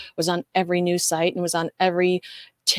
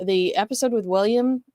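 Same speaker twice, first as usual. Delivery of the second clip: ordinary talk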